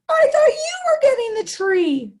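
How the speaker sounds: high, loud panicked wail; beaver's panicked cry. Acted. Beaver yelling at friends about the missing tree, panicked. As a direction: cry out in alarm at the forgotten tree. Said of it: "The beaver says".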